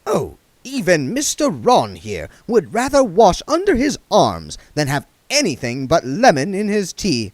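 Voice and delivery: high, fussy genteel voice; prissy old vagrant, fussing; duck man voice. A narrator's reading.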